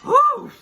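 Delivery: high, loud whooshing exhale; big sigh of release; loud whew. Real, not acted. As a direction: blow out the built-up emotion in one whew. Exhales after fighting back tears.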